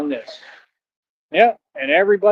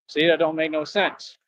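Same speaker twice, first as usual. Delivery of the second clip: ordinary talk, nothing marked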